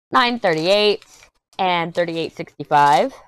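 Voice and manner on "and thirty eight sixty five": ordinary delivery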